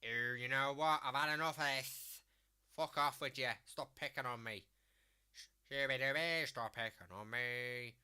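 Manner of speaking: loud, indignant Scouse bird act. Acted. Demonstrating the Scouse bird on the karaoke, loud complaining. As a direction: put on a fed-up Scouse bird, loud at first. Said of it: "is the Scouse bird".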